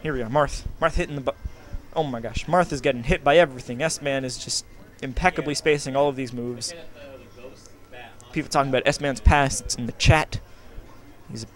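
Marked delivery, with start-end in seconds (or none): none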